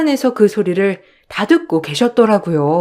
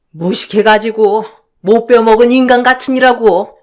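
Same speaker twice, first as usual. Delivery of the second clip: loud, raised-pitch angry confrontation; furious mother; 4 semitones higher, 5 dB louder than usual. Acted. Mother confronting the mother-in-law, loud and furious. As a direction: tear into her without letting up. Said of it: "아주 화가 난 채로요".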